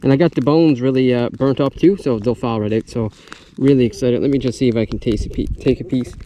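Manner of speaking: ordinary talk, nothing marked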